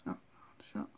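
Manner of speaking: sneezing mid sentence; catching a sneeze. Real, not acted. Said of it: "J'éternue".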